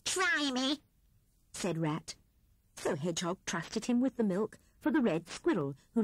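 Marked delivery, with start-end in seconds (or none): 0.1-0.8 a hint of eager squeak; lightly voiced Rat. A narrator's reading. Rat eagerly offering help to Hedgehog, lightly voiced. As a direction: offer help a little higher.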